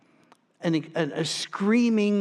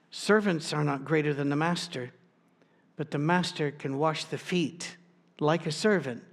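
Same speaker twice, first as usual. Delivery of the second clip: ordinary talk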